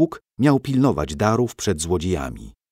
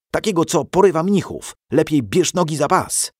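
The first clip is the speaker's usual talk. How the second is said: excited boast; 8 semitones higher, 5 dB louder than usual. Acted. Boy bluffs to scare Piotrek.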